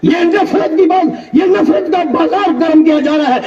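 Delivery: high, shouted rebuke from the pulpit; indignant preaching. Acted. Preacher rebuking preachers who ignore each other, indignant preaching. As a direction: scold the crowd with disbelief.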